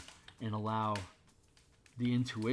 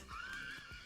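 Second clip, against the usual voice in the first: high burst of laughter; 13 dB quieter than usual. Real, not acted. Laughing at kid memory.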